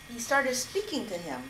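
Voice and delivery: plain reading voice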